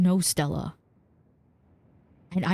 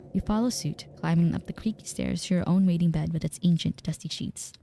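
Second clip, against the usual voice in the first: story narration. A narrator's reading. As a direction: read it like spooky game narration. Game narration read aloud.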